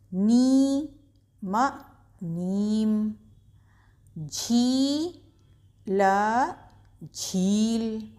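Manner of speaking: slow, drawn-out syllable-by-syllable reading; slow syllable drill. Shown as a demonstration. Teacher reading syllables to children, drawn out. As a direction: spell each syllable out slowly, then join them. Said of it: "खींच के बोलते हैं".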